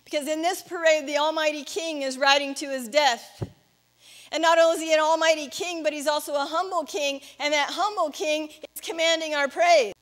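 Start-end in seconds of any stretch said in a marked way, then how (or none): none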